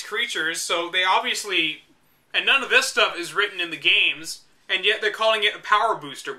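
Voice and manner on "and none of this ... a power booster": normal talking voice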